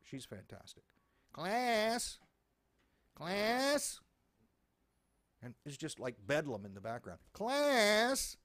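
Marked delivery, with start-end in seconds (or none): 1.3-4.0 nun over a noisy classroom, mock shout; nun calling class. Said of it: "Sister Mary Elephant. She's fantastic". 7.3-8.4 nun over a noisy classroom, mock shout; nun calling class. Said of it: "Sister Mary Elephant".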